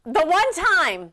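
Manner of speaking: high, drawn-out insistence; insistent. Real, not acted. Host pushing past the co-host's interruption, insistent. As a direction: stretch out THE ONE TIME, pitched high. Host talks over co-host to continue.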